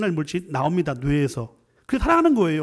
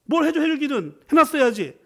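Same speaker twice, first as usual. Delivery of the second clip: high, louder scolding snap; angry husband; 8 semitones higher, 4 dB louder than usual. Acted. Husband berating his wife over dinner, angry scolding. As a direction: scold her for the missing meal. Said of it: "이거 화를 내, 무섭게".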